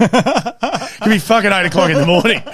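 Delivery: cracking up while telling it; laughing. Real, not acted. Laughing as he tells his mate about mum.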